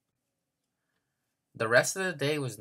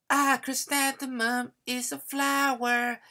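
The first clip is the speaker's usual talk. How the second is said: smug know-it-all; 14 semitones higher than usual. Acted. Victoria showing off at nap time, smug and high.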